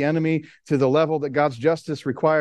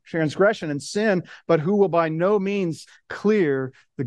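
solemn, rising scripture read, higher and stronger as it goes; 4 semitones higher than usual. Acted. Pastor reading Exodus to the church, solemn proclamation. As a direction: proclaim the verses to the congregation.

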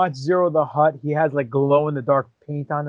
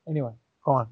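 ordinary talk, nothing marked

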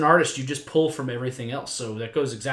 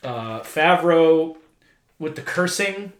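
ordinary talk

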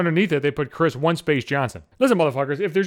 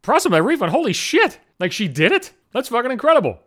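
high-pitched retold disbelief, climbing higher; acted astonishment; 6 semitones higher than usual. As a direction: replay being stunned by good news. Acted reaction to surprise refund news.

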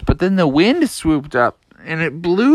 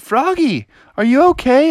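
concerned friend; 9 semitones higher than usual. Acted. Family concerned for crying Froggy.

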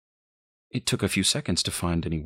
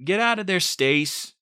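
high, raised-voice command; ordering; 14 semitones higher, 4 dB louder than usual. A narrator's reading. Boyfriend orders mother out of room, raised-voice command. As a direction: order them out, fed up. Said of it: "Harry ordered".

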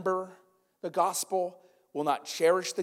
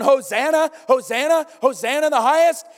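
loud, high, jubilant cheering; crowd yelling praise; 7 semitones higher, 8 dB louder than usual. Retold or imitated. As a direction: shout it like the whole crowd is cheering. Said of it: "The people are yelling".

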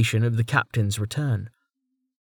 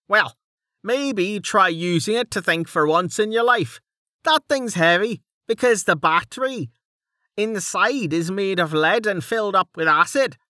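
higher, scornful Dell; Dell, mocking; 9 semitones higher than usual. Dell lectures Mig on the battery, scornful and higher. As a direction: lift the voice and talk down to him.

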